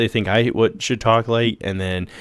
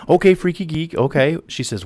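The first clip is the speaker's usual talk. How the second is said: normal talking voice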